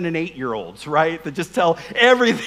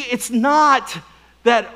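normal talking voice

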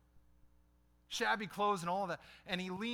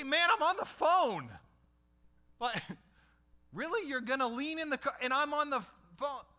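high, loud exasperated yell; irritated yell at a beggar, higher and louder; retold angry yell; 7 semitones higher, 4 dB louder than usual. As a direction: snap at the guy leaning into the car. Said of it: "my response was to yell at him".

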